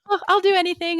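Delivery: high, loud, laughing self-mockery; twenty-two-year-old self voice. Woman mocking her own past desperation to please, high twenty-two-year-old voice. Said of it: "that's my twenty-two-year-old voice".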